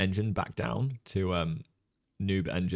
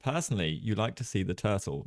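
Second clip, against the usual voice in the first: ordinary talk, nothing marked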